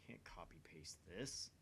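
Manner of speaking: half-whispered grumble under the breath; muttered complaint. Acted. Hacker friend griping at an uncopyable image, whispered mutter. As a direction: grumble it to yourself, barely voiced. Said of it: "Dara mutters some complaint".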